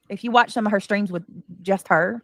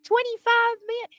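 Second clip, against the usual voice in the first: gushing high greeting; 14 semitones higher than usual. Real, not acted. Gushing greeting to loyal member.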